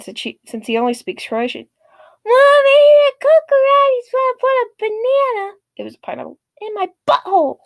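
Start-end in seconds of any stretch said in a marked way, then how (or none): 2.2-5.5 put-on high loud childish whine; whiny kid tattling. Entitled kid tattles to his mom, high loud whine. 6.6-7.6 put-on whiny kid. Kid tattles to mom.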